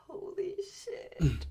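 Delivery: unvoiced, slow holy shit; awed whisper. Stunned by the pen hit, whispered slowly. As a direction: breathe it out, stunned.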